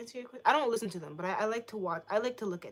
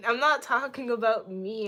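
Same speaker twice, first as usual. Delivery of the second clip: ordinary delivery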